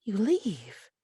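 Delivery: hushed, tight whisper; whispered warning. Acted. Mildred warns the intruder to leave, in a tense whisper. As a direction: whisper the threat.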